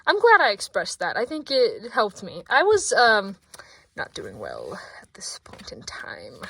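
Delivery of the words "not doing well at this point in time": breathy hushed confession; whispered. Real, not acted. Vlogger whispering to viewers she's not doing well. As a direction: whisper it, barely voiced. Whispered aside about struggling.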